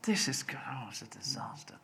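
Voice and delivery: whispered dismay; horrified thought. Retold or imitated. Writer thinking his casting is a disaster, hushed horror. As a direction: breathe it out in a despairing whisper. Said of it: "I can remember thinking".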